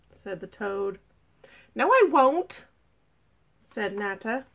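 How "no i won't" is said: loud, high, stubborn retort; defiant refusal. Acted. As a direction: refuse loudly with pitch jumping up. Nata defiantly refuses the toad.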